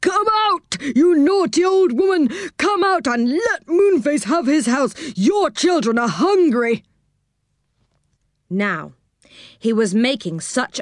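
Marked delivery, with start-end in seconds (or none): 0.0-6.8 shouting at the top of his voice. A narrator's reading. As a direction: yell up at the house. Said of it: "He shouted at the top of his voice".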